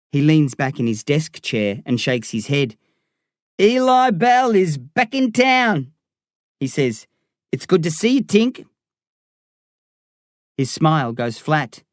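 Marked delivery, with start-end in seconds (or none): 3.6-8.7 Darren, cocky gangster. Acted. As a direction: rib your old mate with swagger.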